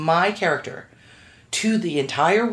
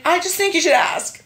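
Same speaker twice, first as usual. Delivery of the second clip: much higher, coaxing plea; pleading; 11 semitones higher than usual. Retold or imitated. Actress coaxing her agent to ask, high plea. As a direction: nudge her gently, pitched up. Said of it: "I'm like".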